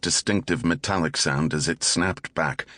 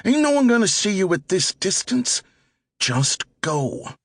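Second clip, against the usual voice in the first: put-on much higher, impatient young thug voice; pushy teenage getaway driver; 13 semitones higher than usual. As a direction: snap at him from the driver's seat. Driver dismisses partner's fear.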